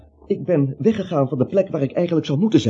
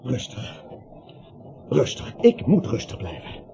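tegen zichzelf; 7 semitones lower, 8 dB quieter than usual. Acted. Alleen, praat zichzelf kalm.

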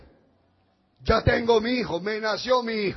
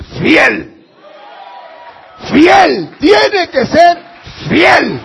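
shouted with rising force; preaching shout; 3 semitones higher, 13 dB louder, slower than usual. Real, not acted. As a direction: shout it at the congregation, slowly.

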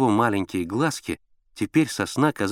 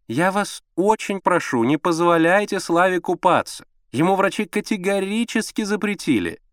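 fussing mother; 10 semitones higher, 3 dB louder than usual. A narrator's reading. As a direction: plead anxiously and emphatically. Fussy mother pleading from bed.

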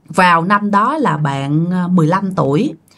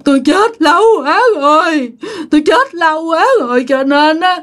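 high, tearful ghost voice; ghostly crying; 7 semitones higher than usual. Retold or imitated. Possessed mother answering the father, crying high. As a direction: weep as a long-dead spirit.

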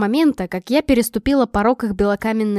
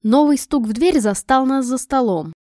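ordinary delivery

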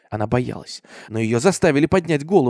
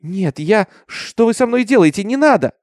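high, rising frightened plea; panicked protest; 9 semitones higher, 5 dB louder than usual. A narrator's reading. Minty begs the men dragging her, high panicked cry. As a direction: plead in alarm, pitch climbing.

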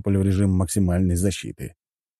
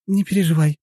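high female voice with a smile; high, smiling woman's voice; smiling reassurance; 10 semitones higher, 4 dB louder than usual. A narrator's reading. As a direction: reassure him lightly, pitched high. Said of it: "улыбнулась Вика".

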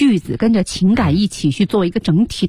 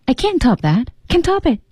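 higher acted cry, leaping on the repeat; last-ditch frenzy; 7 semitones higher than usual. Teacher echoing the played clip, acted last frenzy. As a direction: imitate a character's final frenzy, pitch jumping up. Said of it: "最后的疯狂".

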